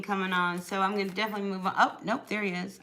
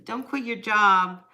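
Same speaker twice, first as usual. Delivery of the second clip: "don't quit your job" slow and louder, landing hard on "job"; firm warning; 6 dB louder than usual. Real, not acted. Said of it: "but don't, listen".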